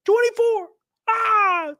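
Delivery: thrilled yell; excited cry. Real, not acted. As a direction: whoop at the count. Near membership goal.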